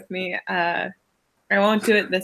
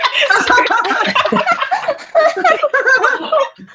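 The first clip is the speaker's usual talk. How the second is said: long, high burst of real laughter; laughing fit; 5 dB louder than usual. Real, not acted. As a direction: crack up loudly and helplessly. Player cracks up mid-scene.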